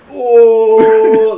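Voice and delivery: sudden loud hammed-up gasp; loud shocked cry. Player hit by pistol shots, loud hammed-up cry. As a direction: cry out as if shot. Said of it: "I'm like".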